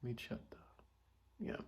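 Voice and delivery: ordinary delivery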